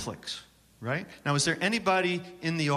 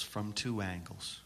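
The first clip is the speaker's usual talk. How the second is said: echoed aside; 4 semitones lower, 10 dB quieter than usual. Shown as a demonstration. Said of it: "did I say from two angles twice".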